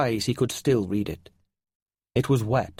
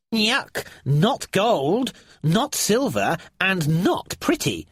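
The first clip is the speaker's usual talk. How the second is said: disgusted creature; 8 semitones higher, slower than usual. A narrator's reading. Nylar tasting a stone.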